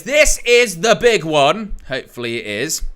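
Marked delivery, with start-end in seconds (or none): 0.0-1.8 loud, high, drawn-out big-fight announcer call; booming read of a fan's message; hyped announcement. Acted. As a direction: belt it out slowly like a hype announcer. Reading out viewer's hype message.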